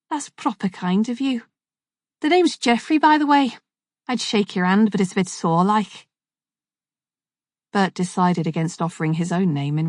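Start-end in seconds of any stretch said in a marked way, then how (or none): 0.1-6.1 higher, friendly Scouse voice; Scouse accent, cheerful and higher. Acted. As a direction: introduce yourself like a chatty Liverpudlian. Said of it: "spoke in a Scouse accent".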